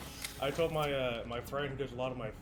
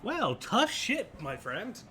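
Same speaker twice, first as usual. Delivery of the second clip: indignant, higher and louder fake apology; mock-apologetic retort; 6 semitones higher, 4 dB louder than usual. Southerner answering someone who dislikes their accent, mock-apologetic. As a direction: stretch out the sarcastic comeback.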